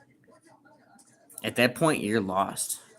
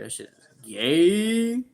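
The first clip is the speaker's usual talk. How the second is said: mocking drawl; 8 semitones higher, 4 dB louder, much slower than usual. Mocking answer to chat question.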